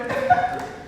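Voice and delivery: high-pitched mock sob; imitated crying. Speaker imitates a student who starts crying, mock sobbing. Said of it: "this girl starts crying".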